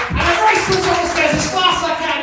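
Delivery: high, loud, very fast rush; excited race telling. Acted. Comedian acting out his sprint up the stairs, breakneck excited shout. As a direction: belt it out at racing speed.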